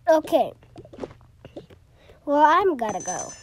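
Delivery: loud, bright goodbye; loud exit. Pretend. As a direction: announce it loudly. Character leaves.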